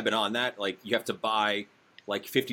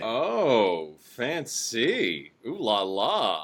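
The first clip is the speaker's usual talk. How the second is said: teasing, swooping ooh-la-la; mock impressed; 2 semitones lower, much slower than usual. Host teasing guest about his rare hat, mock impressed. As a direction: play up how fancy it is, drawn out.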